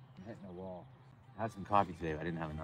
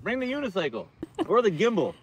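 loud, high teasing call; 12 semitones higher, 12 dB louder than usual. Real, not acted. Teasing a friend from afar, loud called-out joke. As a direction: yell the joke across to him.

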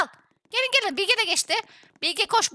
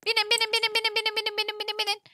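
high monotone chant that fades softer; rapid repeated urging; 3 semitones higher, 5 dB quieter, faster than usual. Real, not acted. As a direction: rattle it off on one note, fading away. Hurrying team onto scooters. (tek perdede, giderek kısılan seri tekrar)

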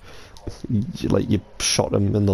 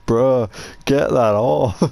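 imitating friend; 3 semitones higher, much slower than usual. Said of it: "he was like".

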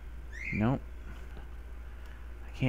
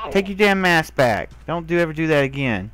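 angry scolding; 3 semitones higher, 4 dB louder than usual. Real, not acted. Handing the mask back, scolding the creature loudly. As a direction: tell it off sharply and hurry the warning.

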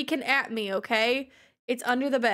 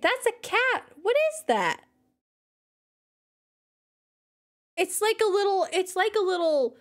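higher, marvelling at a fluffy cat; higher excited wonder; amazed delight; 5 semitones higher than usual. Real, not acted. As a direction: gush in disbelief.

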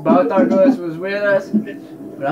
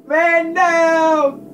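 mock announcement; 11 semitones higher than usual. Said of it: "He was just like".

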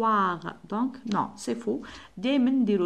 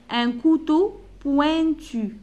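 Un couteau pointu slow and higher; slow high model; 6 semitones higher, 5 dB louder, much slower than usual. Shown as a demonstration. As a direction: stretch Un couteau pointu, lifting the voice. (Un couteau pointu ببطء وبصوت أعلى)

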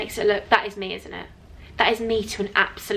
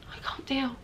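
very soft, breath-only murmur; hushed and overcome; overwhelmed whisper; 3 semitones higher, 13 dB quieter than usual. Real, not acted. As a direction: whisper it, too touched to speak up. Overwhelmed by a thoughtful present.

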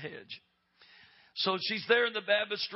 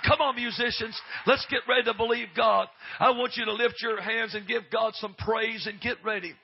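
high, loud, shouted preaching; preaching at full force; 8 semitones higher, 10 dB louder than usual. Real, not acted. Preacher calling the church up to the altar, full-force preaching. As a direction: drive the congregation to its feet.

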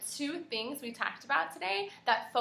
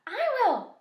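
high, loud eager dog cry; cried out high; 11 semitones higher, 8 dB louder than usual. A narrator's reading. Teacher reading the dog's eager cry, high and loud. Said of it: "cried the dog".

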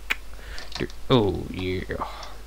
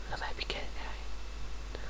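whispering; 16 dB quieter than usual. Real, not acted. Joking quietly aside.

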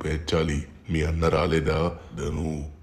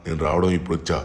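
ordinary talk

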